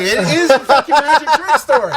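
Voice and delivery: very high, loud comic exclamation; over-the-top delighted confirmation; hammed-up excitement. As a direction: whoop it out loudly in a high voice.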